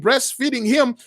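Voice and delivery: ordinary delivery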